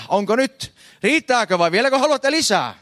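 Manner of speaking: rising hectoring shout at the congregation; mock preacher shout. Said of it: "huusi sinne oikein".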